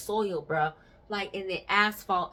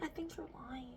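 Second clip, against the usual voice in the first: soft suspicious; 10 semitones higher, 14 dB quieter than usual. Retold or imitated. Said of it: "I was like".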